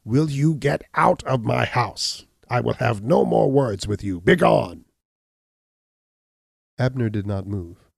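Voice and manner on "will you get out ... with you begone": slow, higher, louder order to leave, ending in a shouted Begone; angry cry. Acted. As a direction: drive him out of the house. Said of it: "he cried".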